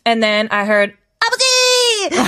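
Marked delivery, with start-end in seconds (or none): 1.2-2.3 tinggi mencicit seperti chipmunk. Retold or imitated. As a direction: teriak kecil melengking lalu tertawa. Meniru lagu yang dipercepat.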